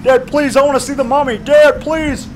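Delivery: put-on high, begging little boy's voice; whiny kid pleading with dad; pleading child. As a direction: beg like a five-year-old on his knees. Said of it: "I said".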